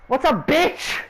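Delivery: cheeky friend. Retold or imitated. Friend rudely greets his mother, imitated cheekiness. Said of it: "my friend be like".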